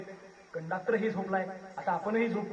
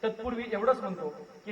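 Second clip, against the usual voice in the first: ordinary talk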